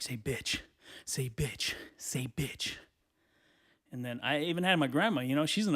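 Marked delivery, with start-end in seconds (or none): 0.0-2.8 low, hushed egging-on; conspiratorial whisper. Acted. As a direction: whisper it like an uncle coaxing a kid to swear. Uncles secretly egging on a kid.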